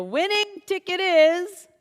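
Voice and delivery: raffle caller. Acted. Announcing the raffle winner.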